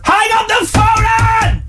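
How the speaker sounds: very high, straining yell; calling out. Real, not acted. Host yells from inside caller's pocket, high shouting. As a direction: holler down an open phone line.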